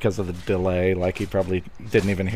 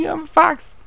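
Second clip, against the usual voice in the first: squeaky fox voice. Acted. Playful squeaky fox cry.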